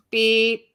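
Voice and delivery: loud, level truck-reversing beep; backup beeper imitation. Mimicking a large person backing up, backup beeper. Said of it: "Beep when a large person backs up".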